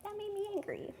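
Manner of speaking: very soft, high-pitched little voice; small mock-angry aside. Comic confiding to the crowd after her husband joke, tiny high voice. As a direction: say it tiny and high, almost to yourself.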